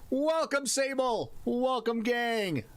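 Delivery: high, sing-out greeting; hearty welcome. Real, not acted. Streamer greeting Sable and the gang, hearty welcome. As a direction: call out each welcome high and drawn out. Hailing the raiders.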